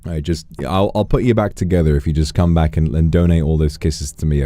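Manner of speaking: usual speaking voice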